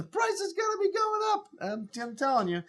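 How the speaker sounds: exaggerated very high-pitched insistence; sky-high pitch, playfully insistent; voice going up. Shown as a demonstration. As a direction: push it up into a comically high voice. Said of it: "my voice just went up".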